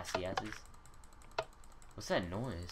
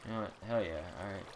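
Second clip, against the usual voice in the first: normal talking voice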